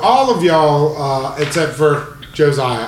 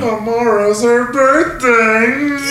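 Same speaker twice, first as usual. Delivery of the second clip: laughing; 12 semitones higher, 5 dB louder, slower than usual. Real, not acted. GM joke, cracking up.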